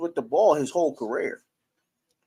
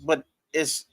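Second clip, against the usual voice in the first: ordinary talk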